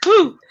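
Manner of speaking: high, loud "woo"; excited whoop into a laugh; celebratory whoop. Real, not acted. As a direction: whoop it out in congratulations. Celebrating guest's tenth episode.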